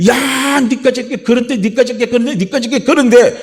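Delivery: high-pitched, repetitive jeering attack; taunting Satan. Acted. Satan attacking a believer, taunting and belittling. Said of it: "사단이가 우리를 공격해 올 때요".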